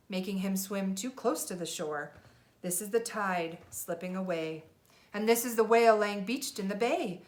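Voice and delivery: put-on lively read-aloud for a child; storybook reading. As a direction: read it like a bedtime picture book. Parent reads picture book aloud.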